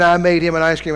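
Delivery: usual speaking voice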